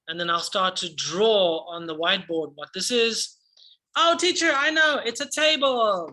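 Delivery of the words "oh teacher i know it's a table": put-on higher, eager child's answer; eager pupil. Shown as a demonstration. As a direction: blurt it out like a keen young pupil. Teacher acts out pupil's answer.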